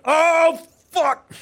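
high, loud startled curse; shocked outburst. Retold or imitated. Dad relives finding the mess, a loud high startled curse. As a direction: yelp it in disgust and shock. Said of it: "And I was like".

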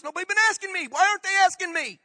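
high, loud protest; loud, high-pitched indignant demand; indignant outcry. Acted. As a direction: cry out as if shut out of everything. Indignant climax of complaint.

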